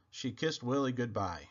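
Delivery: ordinary delivery, nothing marked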